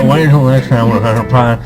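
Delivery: usual speaking voice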